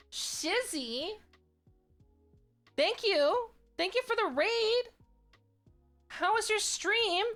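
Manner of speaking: high, loud and thrilled thank you; excited greeting. Real, not acted. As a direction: greet the raider with a burst of joy. Thanking a raider on stream.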